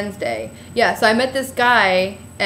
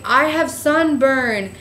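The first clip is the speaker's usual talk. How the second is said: slow complaint; 3 semitones higher, much slower than usual. Retold or imitated. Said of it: "And I was like".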